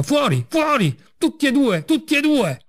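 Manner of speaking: high, cracking yell to throw them out; furious shout. A narrator's reading. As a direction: scream them out of the room, voice gone high. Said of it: "gridò con una voce mutata".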